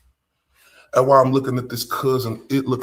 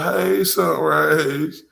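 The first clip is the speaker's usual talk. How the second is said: imitating toddler slowly; 4 semitones higher, 5 dB louder, much slower than usual. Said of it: "He said".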